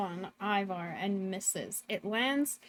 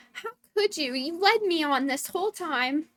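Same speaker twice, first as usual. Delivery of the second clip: raised pitch, wounded protest; acted betrayed outcry; hurt accusation; 6 semitones higher than usual. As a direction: confront them as the one betrayed. Ron confronts Tima and Gwyn.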